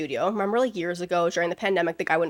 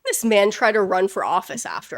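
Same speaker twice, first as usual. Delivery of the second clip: usual speaking voice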